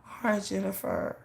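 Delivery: softly conceding; meek. Retold or imitated. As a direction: give in quietly. Meek reply.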